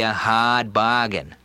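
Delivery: slow Boston "hard bargain" with dropped r, hahd bahgain; JFK Boston accent. Shown as a demonstration. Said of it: "the Boston accent there hit me".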